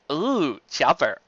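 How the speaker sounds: ordinary delivery, nothing marked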